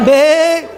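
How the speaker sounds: high, loud playful baa; sheep bleat. Pretend. Preacher leading congregation as sheep, loud pretend bleat. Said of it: "¿Cómo dicen las ovejas?".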